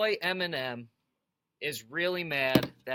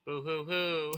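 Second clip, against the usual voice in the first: mock crying. Mock crying at [name]'s complaint.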